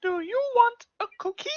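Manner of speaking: high sing-song puppet question. Pretend. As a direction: coax the child as a puppet. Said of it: "puppet offering food".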